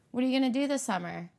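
fast Philly way of asking "What are you gonna do this summer?", pitch a bit up; Philly question tune. Shown as a demonstration. Philly speaker demos her own home accent's question, quick. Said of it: "That's how we ask them".

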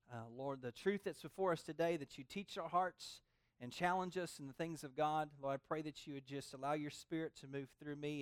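soft, hushed pastor's prayer. Acted. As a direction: pray quietly over the congregation. Pastor prays before preaching.

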